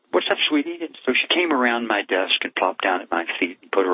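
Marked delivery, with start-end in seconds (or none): 0.1-0.8 a much higher, gentle call to a daughter; tender fatherly question. Retold or imitated. Father gently asks sad daughter.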